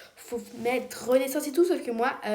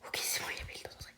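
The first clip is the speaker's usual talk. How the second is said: fast whisper; 13 dB quieter, much faster than usual. Real, not acted. Relieved after escaping.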